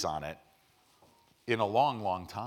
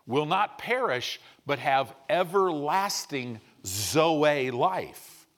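slow, emphatic ending; 2 semitones higher, 4 dB louder, slower than usual. Real, not acted. Emphatic scripture finish.

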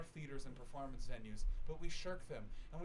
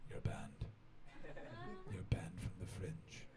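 low, near-whispered pronouncement; low voice, almost a whisper; hushed mock verdict; 6 semitones lower, 3 dB quieter, slower than usual. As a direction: imitate a slow, hushed banning. Old man retorts to guard.

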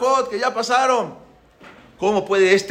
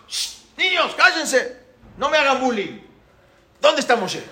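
annoyed Pharaoh; 6 semitones higher, slower than usual. Retold or imitated. Pharaoh hushing the teasing children.

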